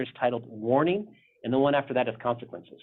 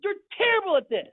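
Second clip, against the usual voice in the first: high, loud mock scolding; single Dave out in a fed-up shout; hammed-up high rant at one man; exasperated pastor yelling; 4 dB louder than usual. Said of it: "he's like, he's like".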